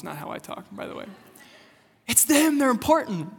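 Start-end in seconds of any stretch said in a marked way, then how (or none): 2.1-3.3 high, excited gush; wife fangirling. Retold or imitated. Pastor repeats his wife's awed reaction to celebrities, fangirling. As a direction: imitate a starstruck fan in a raised voice. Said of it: "That's how she talks".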